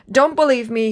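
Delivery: usual speaking voice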